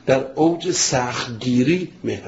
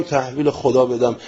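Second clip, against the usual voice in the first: ordinary talk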